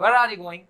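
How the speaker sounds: Where as one short syllable; natural spoken pronunciation. Shown as a demonstration. Teacher showing how people talk, short linked Where. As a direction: link Where straight into are. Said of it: "लोग ऐसे ही बोलते हैं".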